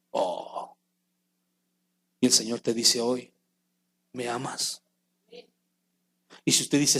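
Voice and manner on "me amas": hushed, tender asking; the Lord asking gently, in a hushed voice; soft question. Acted. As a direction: ask it softly, almost to yourself.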